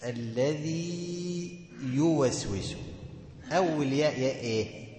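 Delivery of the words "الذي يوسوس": الذي يوسوس chanted slowly with the ya elongated; tajweed demo. Shown as a demonstration. Teacher showing the key phrase to the student in slow recitation. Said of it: "الشاهد في السورة كلها أنا عايز أجيبه لك".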